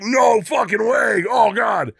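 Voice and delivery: stunned crowd yelling in disbelief; crowd shouting. Retold or imitated. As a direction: yell it like a stunned audience. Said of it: "And everyone's like".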